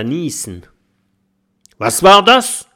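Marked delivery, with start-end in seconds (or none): 1.8-2.7 loud, high robber's shout; sharp rising shout, much louder; calling out. A narrator's reading. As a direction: bellow a startled question. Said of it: "ruft der dicke Räuber".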